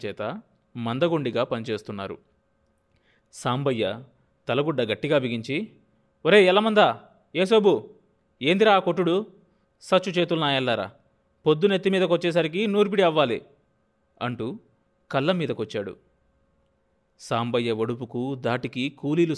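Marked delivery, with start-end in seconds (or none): 6.2-13.4 loud, higher, rough bark at the workers; scolding shout. A narrator's reading. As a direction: drive them on with a sharp yell. Landlord scolds slow threshing laborers.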